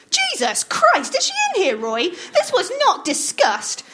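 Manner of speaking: wife appalled at the other woman; outraged Maud. A narrator's reading. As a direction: clutch your pearls in horror. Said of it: "said Maud".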